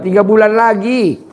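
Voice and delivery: usual speaking voice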